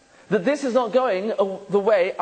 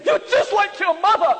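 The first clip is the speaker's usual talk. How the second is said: higher, louder hammed-up jab, laughing through it; mock angry accusation; 9 semitones higher, 3 dB louder than usual. Spouse accusing partner of being like mother, mock shout. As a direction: fling the insult at the spouse in jest.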